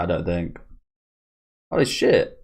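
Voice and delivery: ordinary talk, nothing marked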